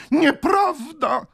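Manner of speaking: very high, slow, loud protest; indignant cry. A narrator's reading. Spinster aunt denies her age, indignant cry. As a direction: wail the denial in outrage. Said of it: "zawołała ciotka panna, której oburzenie".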